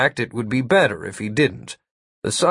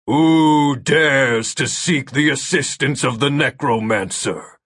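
thunderous voice. Acted. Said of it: "A thunderous voice echoed through the forest".

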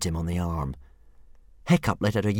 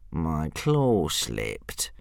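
whiny dragon; 4 semitones lower, much slower than usual. A narrator's reading. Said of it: "whined Hook Fang".

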